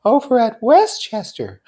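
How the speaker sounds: put-on high young woman voice; Daisy voice. A narrator's reading.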